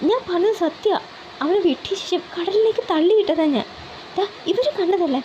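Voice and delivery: high, nervous pleading; flustered and scared. Acted. Henchman defends himself to angry Ali, high panicky pleading. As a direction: scramble to convince the boss. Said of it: "പരിഭ്രമത്തോടെ പറഞ്ഞു".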